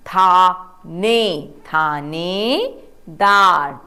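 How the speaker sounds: थाने then थानेदार built up very slowly; slow sounding out. Shown as a demonstration. Teacher to class, slowly building the word. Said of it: "थ को आ की मात्रा और न".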